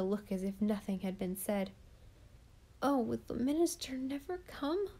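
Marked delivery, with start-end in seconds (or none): 2.8-4.9 moaning. A narrator's reading. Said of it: "moaned Felicity in my ear".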